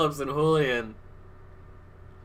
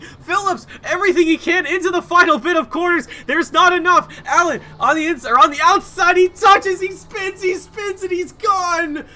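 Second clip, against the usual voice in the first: screaming play-by-play, much higher and louder; excited commentary; 6 semitones higher, 4 dB louder than usual. Real, not acted. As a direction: call the last lap at fever pitch. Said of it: "I got too excited".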